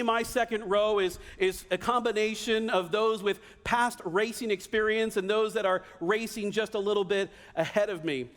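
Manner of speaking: usual speaking voice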